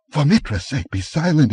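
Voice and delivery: a touch of panic in the merchant's voice; alarmed hush. A narrator's reading. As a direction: beg him to stop, startled. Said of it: "ejaculated Publio".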